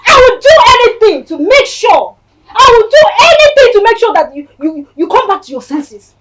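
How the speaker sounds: desperate tearful yell; crying shout. Acted. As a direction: shout it through tears. Pleading shout at sister.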